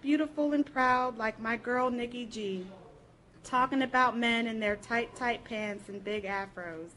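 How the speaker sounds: ordinary talk, nothing marked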